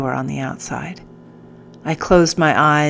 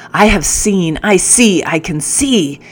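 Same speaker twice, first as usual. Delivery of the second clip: roared; 3 semitones higher, 4 dB louder than usual. A narrator's reading. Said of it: "he roared".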